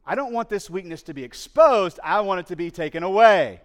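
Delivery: loud, high preaching cry; preaching at full force. Acted. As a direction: preach it out as if pleading. Preacher voices the men's wish.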